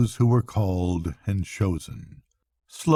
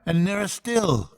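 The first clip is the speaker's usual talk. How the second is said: much higher, joyous exclaim; thrilled as the music nears; joyous cry; 9 semitones higher than usual. Acted. Rat cries out joyously to Mole as the piping grows. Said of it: "cried the Rat joyously".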